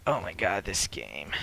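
ordinary talk, nothing marked

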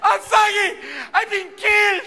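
high, loud hammed-up wailing; feigned agony. Soccer players falling down untouched, feigned agony. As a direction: cry out as if mortally injured, badly faked. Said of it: "fall down and pretend like".

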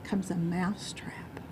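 slow, hushed storytelling reveal; soft, low-key, drawn-out reveal; ominous hush. A narrator's reading. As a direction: drop almost to a whisper for the dread.

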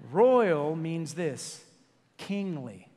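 very slow, stressing "Royal"; slow definition. Shown as a demonstration. Preacher defining royal for the congregation, very slow. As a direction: lay out "Royal means this" word by word. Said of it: "defining word slowly".

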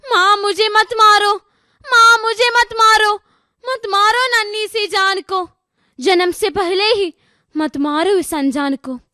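high-pitched imploring cry, easing at the end; piteous plea. Acted. Unborn child begging mother for life, piteous pleading. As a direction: plead pitifully like a helpless child, in verse. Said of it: "उसकी करुण पुकार".

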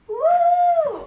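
high, joyful woo; squealed woo; excited whoop. Real, not acted. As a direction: cheer as the lights come on. Bulbs light up.

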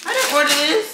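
high, puzzled protest; confused surprise. Real, not acted. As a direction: raise your voice in disbelief. Surprised by wrong package.

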